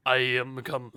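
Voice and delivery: lightly put-on character voice; "I am come" voice. Streamer praises his own catchphrase, lightly in character voice. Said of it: "say that in your I am come voice".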